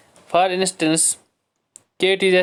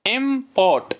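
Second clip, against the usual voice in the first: "Import" raised, in two distinct beats; syllable by syllable; 7 semitones higher than usual. Shown as a demonstration. Teacher presenting a two-syllable word, syllable by syllable. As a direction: pitch up "import" and separate इम from port. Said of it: "इसमें भी दो syllable हैं".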